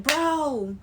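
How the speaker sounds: high, sugary fake-friend gushing; imitating the over-eager user friend; mock fake friend. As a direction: gush like a clingy fake friend. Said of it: "them the ones that be like".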